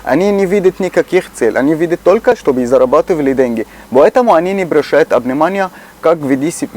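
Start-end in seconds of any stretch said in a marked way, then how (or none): none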